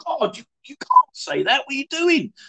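high, shocked mock of his younger self; high scandalised protest. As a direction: squeak it out, scandalised. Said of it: "And I'm like".

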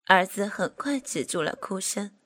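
ordinary delivery, nothing marked

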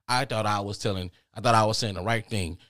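ordinary delivery

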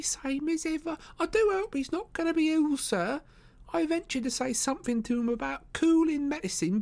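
very high-pitched landlady voice; Missus Hudson, worried. Acted. Landlady worries about her restless lodger, fretful falsetto. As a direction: fret in falsetto.

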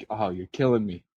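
ordinary talk

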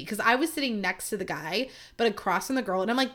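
ordinary talk

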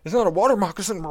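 high, fast, loud hammed-up yell; mock struggling shout. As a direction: shout it as if holding someone under. Pretends to drown a friend underwater.

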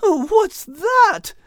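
very high, drawn-out alarm; high-pitched startled little fellow; startled exclamation. Acted. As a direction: exclaim high and slow in surprise. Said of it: "Al exclaimed".

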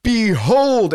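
loud, high "Behold" like a street prophet; mock crazy proclaimer. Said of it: "I'd hear somebody".

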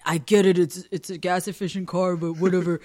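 lightly mock a grumbling father; dad giving crap. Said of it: "giving me crap about it".

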